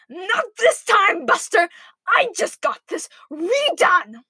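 high, drawn-out, outraged protest; crying out. A narrator's reading. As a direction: wail it as the alarmed pig. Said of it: "he cried".

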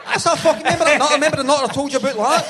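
high, loud and fast, pointing him out; excited recognition. Retold or imitated. Stranger seeing him on TV telling a friend, high fast and loud. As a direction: gabble it out like spotting someone famous.

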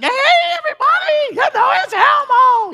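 very high, loud squeaky puppet voice; Elmo voice. Retold or imitated. Dad in costume greeting the kids, high squeaky Elmo. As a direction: belt it out like Elmo greeting the party. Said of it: "I had the voice and everything".

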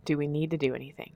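soft, fast repeat of the question; hushed rapid echo; quiet, quick echo. Shown as a demonstration. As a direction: mutter it back to yourself quickly. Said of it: "that always gets you?".

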